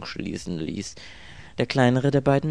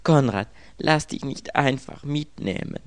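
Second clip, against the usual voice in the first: higher, plaintive plea; louder, distressed lament; wailing; 6 semitones higher, 5 dB louder than usual. A narrator's reading. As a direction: beg him in a falling wail. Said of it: "jammerte das Mädchen".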